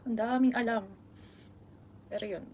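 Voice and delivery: ordinary delivery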